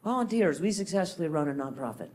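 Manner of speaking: soft, fast, flat letdown; deflated mutter. Shown as a demonstration. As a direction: throw it away quietly. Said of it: "contrasting Volunteers with Titans".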